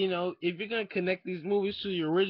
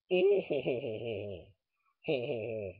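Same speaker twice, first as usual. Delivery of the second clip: Bane laugh; 6 semitones lower than usual. Retold or imitated. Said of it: "I am Bane".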